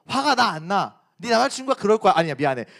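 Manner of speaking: ordinary delivery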